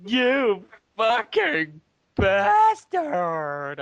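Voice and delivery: high, slow, drawn-out mock cry; mock moan. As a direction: wail it like a betrayed heroine. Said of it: "I moaned".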